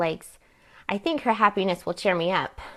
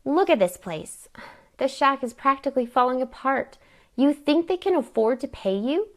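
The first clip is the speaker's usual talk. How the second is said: higher-pitched, indignant argument; 6 semitones higher than usual. Acted. Aunt defends herself over the money, indignant. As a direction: argue it, climbing in pitch to the question.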